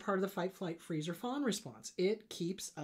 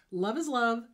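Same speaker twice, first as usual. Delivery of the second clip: ordinary talk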